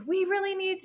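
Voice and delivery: a hint of urgent midwife; high midwife voice.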